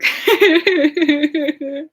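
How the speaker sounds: long bright laugh; real laugh. Real, not acted. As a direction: laugh out loud. Laughs at own thought.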